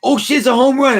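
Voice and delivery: put-on high, loud burst of a kid sure it's a homer; childhood excitement. Remembering being a kid at the game, excited yell.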